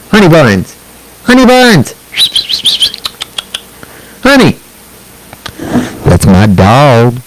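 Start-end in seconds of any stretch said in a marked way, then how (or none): 0.1-4.6 high sing-song call to the dog. Real, not acted. Owner calling his dog Honey Buns across the room.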